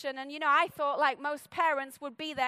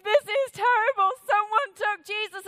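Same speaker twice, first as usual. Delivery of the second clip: a higher, louder, anguished cry of loss; a lightly voiced, despairing Mary; distraught Mary; 5 semitones higher, 7 dB louder than usual. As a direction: wail it like Mary finding the empty tomb. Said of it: "with a disposition of".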